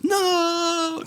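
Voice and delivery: high, drawn-out "no"; mock heroic cry. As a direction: cry out like a slow-motion movie hero. Acting a heroic rescue from bus.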